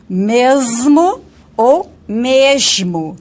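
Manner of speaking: mesmo with s then with sh; mesmo variants. Shown as a demonstration. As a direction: say mesmo both ways slowly. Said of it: "Independe de eu falar". (mesmo dito com s e com chiado)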